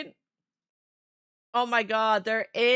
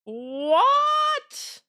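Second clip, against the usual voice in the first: shocked surprise; 13 semitones higher, 4 dB louder than usual. Real, not acted. Sudden big donation appears.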